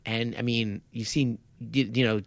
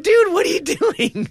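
much higher, louder and slow, laughing through it; a half-laughing cry of disbelief at a terrible move; 7 dB louder than usual. Retold or imitated. To his co-host about the crucifix, laughing disbelief. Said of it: "I'm like".

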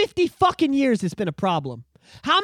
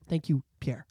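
ordinary talk

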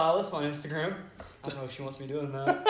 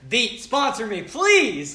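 mock plea; 9 semitones higher, 9 dB louder, much slower than usual. Pleading jokingly to a sponsor.

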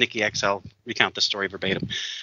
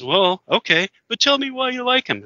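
put-on much higher, insistent drunk voice; drunk man pressing; 12 semitones higher than usual. Drunk passenger demanding an answer, much higher.

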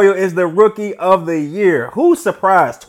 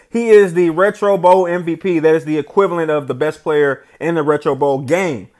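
ordinary delivery

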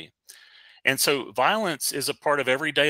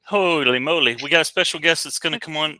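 louder, bright announcer burst; loud playful Holy moly; excited; 4 semitones higher, 6 dB louder than usual. Real, not acted. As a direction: hype the surprise guest.

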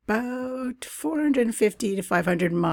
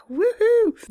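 high, loud celebratory whoop; excited woohoo; 5 dB louder than usual. Real, not acted. Host celebrating her website going live, loud and high. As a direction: cheer like the launch finally happened.

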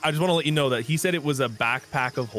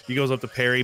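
usual speaking voice

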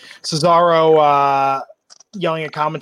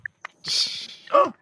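gasp. Real, not acted. Phone knocked over.

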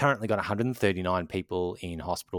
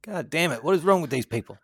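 escalating comedy rant; 8 semitones higher than usual. Real, not acted. Exasperated at family gathering cases.

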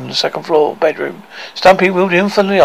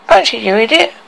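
yelling; 6 semitones higher than usual. A narrator's reading.

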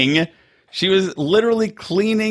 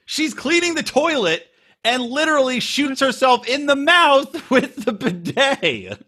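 incredulous; 11 semitones higher than usual. Real, not acted. Incredulous retelling of bidet scene.

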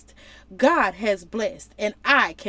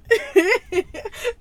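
high laugh; 10 semitones higher, 4 dB louder than usual. Real, not acted. Laugh after his point.

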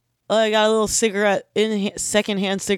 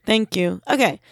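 ordinary talk

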